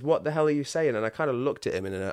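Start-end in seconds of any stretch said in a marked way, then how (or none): 0.0-0.9 higher and louder, squaring up; pumped-up, louder challenge; pumped-up demand. Retold or imitated. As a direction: confront him, ready to scrap. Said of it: "I was quite pumped and I said".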